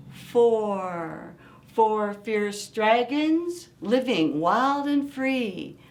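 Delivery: usual speaking voice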